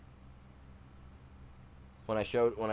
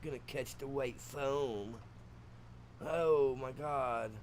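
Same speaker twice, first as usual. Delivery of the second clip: higher, put-on surfer dude voice; surfer boy talk; 4 semitones higher, 4 dB quieter, slower than usual. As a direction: play a stoked surfer with drawn-out words. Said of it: "Switch up to some surfer boy talk".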